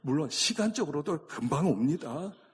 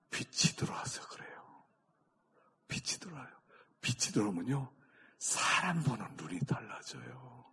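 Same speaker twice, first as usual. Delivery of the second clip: soft, breath-heavy near whisper; hushed preaching; 7 dB quieter than usual. Real, not acted. Preacher tells congregation light changes their sight, hushed. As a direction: let each phrase sink in quietly.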